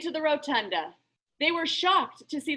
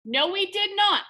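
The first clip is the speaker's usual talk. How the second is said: ordinary delivery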